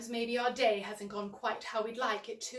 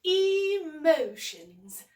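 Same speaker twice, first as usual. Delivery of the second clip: Emotions said very slowly and louder, each syllable drawn out; slow, clear model word; 7 dB louder, much slower than usual. Shown as a demonstration. Host leading children at home to repeat, slow louder Emotions. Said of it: "Can all of you at home say that with me?".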